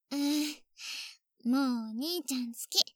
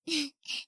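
soft giggle; 5 dB quieter than usual. Acted. Teasing brother, amused.

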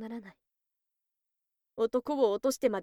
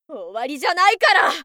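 loud, higher, determined yell; fierce shout; 5 semitones higher, 11 dB louder than usual. Acted. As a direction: shout it at the fallen man. Fierce resolve mid-fight.

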